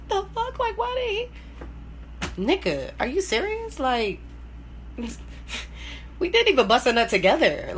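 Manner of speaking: high, incredulous "the fuck?", then fast; playing her younger self, stunned and outraged; shocked disbelief. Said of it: "Like, I would've been like".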